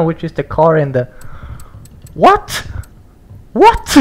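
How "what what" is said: much higher, short what what; real high-pitched shock; shocked squeaks. Real, not acted. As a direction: squeak in disbelief then laugh. Shocked by new car.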